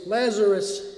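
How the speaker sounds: a loud summoning cry; loud call. Acted. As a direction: call the name out loud into the tomb. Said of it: "he cried out in a loud voice".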